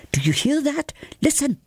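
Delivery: high, sharp urgent call; urgent alarm. Acted. Urgent nudge, hearing cultist.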